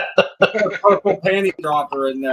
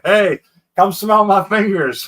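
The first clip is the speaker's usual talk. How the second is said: loud, high, drawn-out call; husband's yell; 7 semitones higher, 9 dB louder, slower than usual. Acted. Husband calling his wife over to smell his fingers, yelling. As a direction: yell it slowly through the house, playfully. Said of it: "for a husband to yell to his wife".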